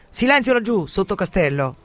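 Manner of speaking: high, loud captain's shout; shouted order. A narrator's reading. Pirate to the doctor in the tavern, shouted. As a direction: call out sharply across the room. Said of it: "gli grida".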